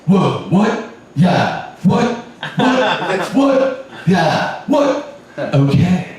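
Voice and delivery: hype-man bark of what. Acted. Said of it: "same vocabulary as Little John".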